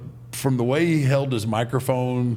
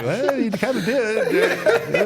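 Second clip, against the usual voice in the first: laughing; 7 semitones higher than usual. Retold or imitated. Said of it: "and you're like".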